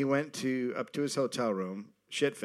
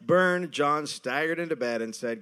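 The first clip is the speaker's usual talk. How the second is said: ordinary talk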